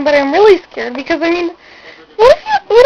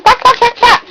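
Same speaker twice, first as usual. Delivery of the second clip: mock quacking; 9 semitones higher, 3 dB louder than usual. Calling herself a chicken.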